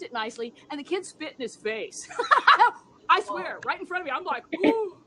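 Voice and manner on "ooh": dismayed reaction. Retold or imitated. Babysitter recalling the spitting child, mock dismay.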